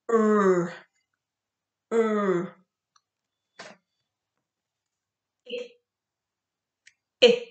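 crisp isolated phonics sounds, each pure and clipped; speech therapist. Shown as a demonstration. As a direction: say each letter sound short and pure, no added vowel. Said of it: "I'll say the sound and then you repeat".

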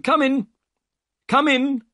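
loud, very high summons; calling out. A narrator's reading. Master calls Holmes's party into his room, calling out. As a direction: call visitors in, high and insistent. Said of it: "said the voice".

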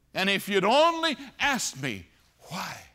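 loud, high anguished cry; loud rebuke. Pretend. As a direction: let it burst out. God's imagined rebuke rising.

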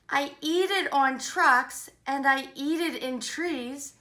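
bouncy rhyming read-aloud; enthusiastic story reading. Acted. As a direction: relish each rhyme for young listeners.

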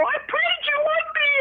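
very high-pitched, gushing relief; overjoyed squeal. Retold or imitated. Telling him on the phone of answered prayers, overjoyed. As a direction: squeal it with elated conviction. Acts out joyful outburst to him.

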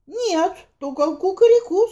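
high rooster crowing the word; cocky rooster, crowing. Acted. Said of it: "сказал петух".

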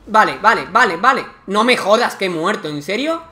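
loud, exasperated outburst at dying; frustrated disbelief. Real, not acted. Character dies in the game.

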